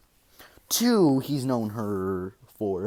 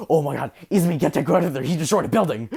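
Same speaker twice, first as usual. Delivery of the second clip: higher, louder panicked call to Izumi; panicked yell; 6 semitones higher, 7 dB louder, faster than usual. Retold or imitated. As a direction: yell it in alarm, pointing at the damage. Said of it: "Shoto's like".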